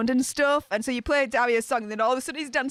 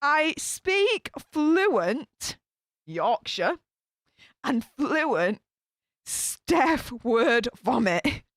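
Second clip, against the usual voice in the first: very slow, emphatic words with gaps; slow, deliberate restart; much slower than usual. Real, not acted. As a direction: spell it out slowly after fumbling. Restarts slowly after tripping over words.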